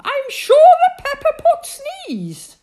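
very high, loud and slow with alarm; queen insisting in alarm, very high and loud; alarmed insistence. Acted. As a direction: insist in a frightened wail. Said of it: "cried the Queen in alarm".

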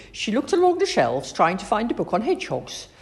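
plain reading voice

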